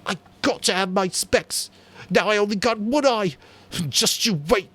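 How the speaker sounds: put-on high, whiny boy's voice with a threat; malevolent Piggy. A narrator's reading. Piggy threatening Jack, high and whiny. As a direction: whine out the threat. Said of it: "He looked malevolently at Jack".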